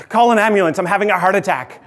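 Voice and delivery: loud, high cry for an ambulance; panicked plea. Retold or imitated. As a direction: shout in alarm to wake someone for help. Wakes wife in panic, begging help.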